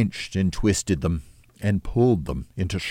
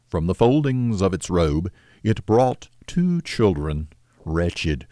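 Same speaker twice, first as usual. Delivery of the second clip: usual speaking voice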